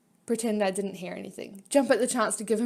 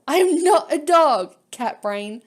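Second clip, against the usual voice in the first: louder, slow, insistent shout; indignant yell; 4 semitones higher, 10 dB louder, slower than usual. Acted. Chrome yelling at her for calling him a dog. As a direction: shout the denial, stung.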